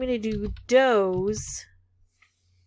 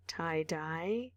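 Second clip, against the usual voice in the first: ordinary talk, nothing marked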